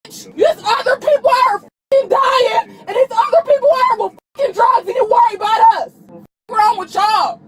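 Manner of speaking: crying while shouting at the officers in real rage; sobbing fury, words spat out; tearful angry yelling. Real, not acted. Arrested woman screaming at the police, crying and furious.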